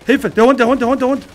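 loud, high cry for help; panicked shout. Real, not acted. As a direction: yell in panic at the dog. Attacked by a dog in game.

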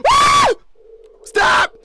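loud frightened pleading; real scared yelling; panicked shouting. Real, not acted. Jump scare, begging.

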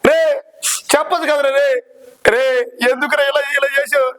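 shocked disbelief. Acted. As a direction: gasp it out as the news lands, voice jumping up. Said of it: "मस्त शेड्స ఉన్నాయి రా నీలా".

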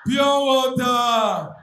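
slow, loud hawker cry; loud hawker call. Retold or imitated. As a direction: call it across the cars. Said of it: "they don't even think about you".

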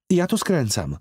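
put-on high child voice; little girl voice. A narrator's reading. Girl tells the man she turns here, in a child's voice. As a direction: say it like a small girl. Girl's line.

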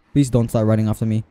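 ordinary talk

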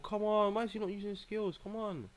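high-pitched pleading complaint; exasperated. Real, not acted. Player urging on a character not using skills, exasperated. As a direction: plead with the game, voice pushed high. Complaining about a game move.

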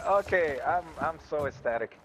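usual speaking voice

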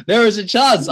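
high, loud and laughing, delighted; laughing excitement. Real, not acted. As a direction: burst out laughing with joy at the news. Excited about product line chance.

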